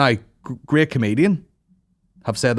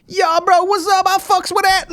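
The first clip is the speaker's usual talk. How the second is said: high, loud mock bro voice; 5 dB louder than usual. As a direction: send up two guys playing it cool. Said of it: "they're all like".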